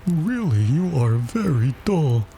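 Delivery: high, drawn-out exasperation; understated turtle huffiness; mildly angry. A narrator's reading. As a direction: scold slowly in a doleful voice. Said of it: "said the Mock Turtle angrily".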